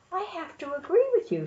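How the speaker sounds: ordinary delivery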